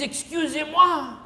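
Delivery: drawn-out mock French "Excusez-moi"; French accent. Frenchman on the bus asks the Cajun, in a French accent. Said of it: "I am from France".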